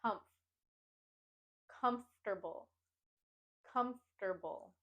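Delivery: comfortable with the weight on COMF; "comfortable" in three syllables, COMF first; stress first syllable. Shown as a demonstration. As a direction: model comfortable as COMF-ter-bull. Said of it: "put the emphasis and stress on".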